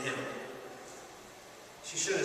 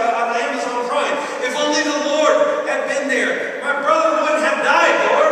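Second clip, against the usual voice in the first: loud, high complaint; 7 semitones higher, 9 dB louder than usual. Acted. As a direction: moan your regrets at God.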